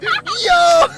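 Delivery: high excited call; excited yell. Retold or imitated. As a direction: shout it up high. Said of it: "I'm like".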